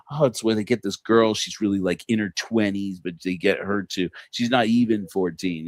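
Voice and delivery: ordinary talk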